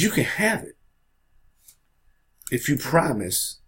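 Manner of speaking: lightly voiced concession, lifted high; Neville Chamberlain. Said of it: "And Neville Chamberlain, he agrees".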